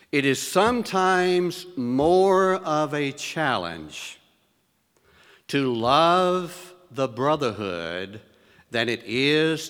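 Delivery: slow, deliberate statement, each word weighed; slow and weighty, letting the congregation take in every word; very slowly. Shown as a demonstration. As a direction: spell it out slowly so it sinks in. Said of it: "I want to say it very slowly".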